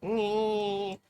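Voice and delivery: long sustained "nee" noise; imitated background whine. Streamer explaining a background noise, imitating its steady whine. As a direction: hold a steady drone imitating the air noise. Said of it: "if you're wondering why there's".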